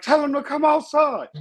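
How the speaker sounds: mock yell.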